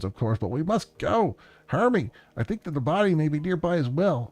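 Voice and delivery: high, excited voice with words spilling out; breathless urgency. Acted. Man tells Hermie of the rescue mission, breathlessly urgent. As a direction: let it tumble out urgently, pitched well up. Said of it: "The words spill out of him in a breathless tide".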